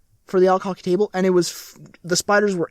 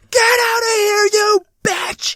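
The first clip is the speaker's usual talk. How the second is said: high screaming yell, louder than usual; 13 semitones higher, 5 dB louder, slower than usual. Retold or imitated. Storyteller replaying his late-night spider yell, yelling. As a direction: re-enact the panicked scream at full pitch. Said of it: "and I yell".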